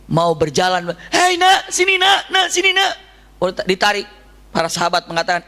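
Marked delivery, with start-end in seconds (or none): 1.1-2.9 high, loud calling. Retold or imitated. Woman calls the boy to come to her, loudly. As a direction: call the boy over across the crowd.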